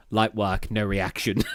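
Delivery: low, soft, deadpan; understated mock nonchalance; unimpressed brag. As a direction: play it cool, voice dropped low. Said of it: "I just went".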